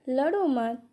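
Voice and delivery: normal talking voice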